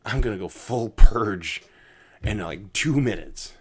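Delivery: loud, slow mock threat; mock threat yelled. Telling his meteor-fearing friend off, a loud slow mock yell. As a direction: bellow it slowly, half joking.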